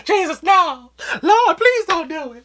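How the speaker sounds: hammed-up, high-pitched begging wail; mock-sobbing cries, stretched out at the end; screaming and hollering. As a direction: plead for mercy like a terrified kid. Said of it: "now I'm screaming and hollering".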